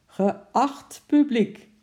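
loud, slow ringmaster's address; high, drawn-out projected announcement; circus director announcing. A narrator's reading. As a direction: announce it grandly to the whole audience. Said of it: "riep de directeur van het circus".

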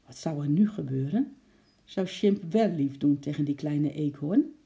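usual speaking voice

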